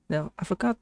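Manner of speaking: normal talking voice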